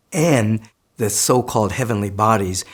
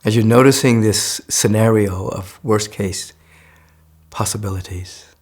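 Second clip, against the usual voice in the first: guided meditation. Acted.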